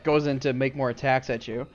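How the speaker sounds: ordinary talk, nothing marked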